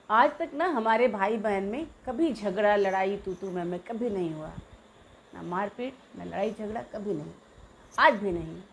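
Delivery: ordinary talk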